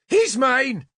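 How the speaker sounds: high claiming shout; Nimue shouting. A narrator's reading. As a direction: stop him, claim the kill. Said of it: "Nimue shouted at me".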